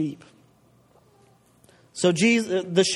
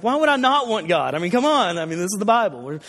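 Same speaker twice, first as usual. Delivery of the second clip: ordinary talk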